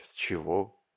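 usual speaking voice

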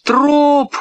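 very high, stretched name calls; girl calling. A narrator's reading. Girl calling the dog from a boat, high calls. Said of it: "позвала девочка".

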